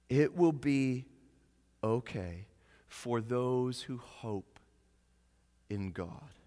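gentle reassurance. Real, not acted. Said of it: "gentle but firm reminder".

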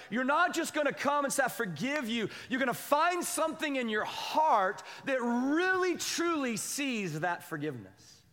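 high, insistent preacher delivery; impassioned high-pitched exhortation, stretching the last words; emphatic preaching. Retold or imitated. Voicing the Lord's demand, preaching intensely.

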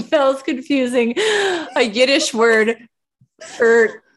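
high, slow, breaking into laughter; fighting the giggles to get it out; laughing while talking. Real, not acted. Explaining through laughter.

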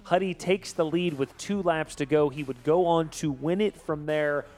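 upbeat sports recap read; highlight-reel announcer. Acted. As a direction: call it like a highlight package. Host reads race highlights.

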